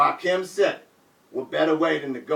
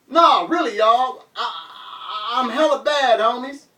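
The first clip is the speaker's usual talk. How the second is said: higher, louder, pleading; insisting; 7 semitones higher, 4 dB louder than usual. Retold or imitated. Bleeding rapper insisting to cheering crowd, loud pleading. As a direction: insist they take him seriously.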